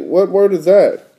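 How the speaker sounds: plain reading voice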